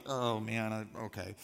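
low, softening, resigned aside; reluctant resignation. Acted. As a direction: give in reluctantly, voice sinking. Introvert husband dreading wife's chat.

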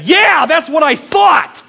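high, loud, slow taunt; mock gloating shout. Counselor pretending to taunt the camper in a slow loud gloat. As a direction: crow it slowly over the beaten kid. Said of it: "No, I didn't say that".

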